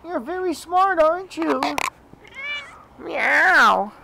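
very high pet-talk voice. Real, not acted. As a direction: coo at the mother cat. Cooing to the mother cat.

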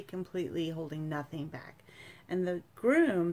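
ordinary talk, nothing marked

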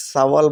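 "শাওয়াল" starting with a plain s, sa-wal; common mispronunciation. Shown as a demonstration. Teacher showing what many people say, common mispronunciation. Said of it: "অনেকেই শাওয়াল বলে থাকেন".

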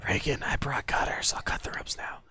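hushed rescue whisper. Acted. Rescuer whispering to the captive at the ropes. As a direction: whisper so the guard won't hear.

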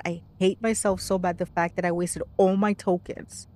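normal talking voice

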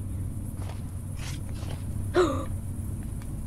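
long voiceless gasp; startled gasp. Real, not acted. Player scared by game, startled gasp. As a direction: suck in breath in fright.

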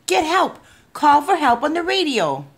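high, pressing demand; urgent plea. A narrator's reading. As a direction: urge him to call right away. Boy begs policeman to radio.

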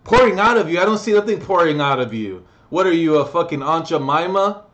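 mocking challenge. Heckler mocking the vlogger, scornful and loud. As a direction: sneer back at him like a heckler.

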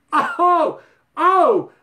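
high loud gasped oh; mock shock. Real, not acted. Realizing the joke went too far, mock alarm. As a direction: caught yourself saying too much. Catching himself after a joke.

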